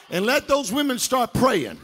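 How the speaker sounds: loud preacher's call to stand; preaching call. Acted. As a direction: rouse the women to their feet. Preaching build to women.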